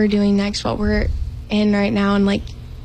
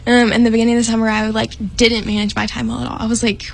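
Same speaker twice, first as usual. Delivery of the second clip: normal talking voice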